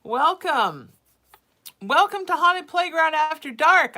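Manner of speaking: big, high show-host greeting; announcer intro. Acted. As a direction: welcome them like a late-night host.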